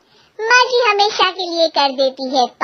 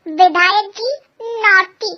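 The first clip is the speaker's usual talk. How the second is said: normal talking voice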